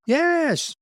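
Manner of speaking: high chirpy yes; a quick high-pitched yes; squeaky mock yes. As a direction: answer brightly and high. Imitating Israeli salesman.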